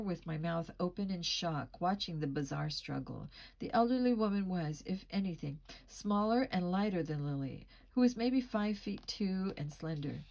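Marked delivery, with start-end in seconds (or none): none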